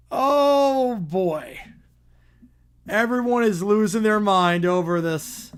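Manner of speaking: ordinary delivery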